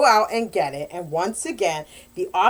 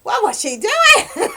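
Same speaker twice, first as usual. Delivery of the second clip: much higher, slowing on what was she doing; scandalized gasp at the page, hammed up; mock shock; 11 semitones higher, 5 dB louder than usual. Reader shocked by a steamy scene, hammed-up disbelief. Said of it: "you're just like".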